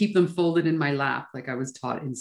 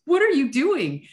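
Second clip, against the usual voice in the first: incredulous; 10 semitones higher than usual. Retold or imitated. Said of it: "We're like".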